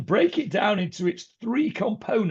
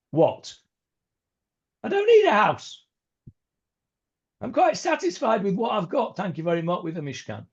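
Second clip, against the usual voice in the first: high, bright mock surprise, then settling; scoffing at the idea of a house, high; incredulous voicing of God; 4 semitones higher than usual. Teacher voicing God declining a house, mock-incredulous.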